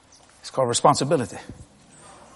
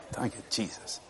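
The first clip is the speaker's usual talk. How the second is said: breathed relief to heaven; whispered thanks; 8 semitones lower, 20 dB quieter than usual. Retold or imitated.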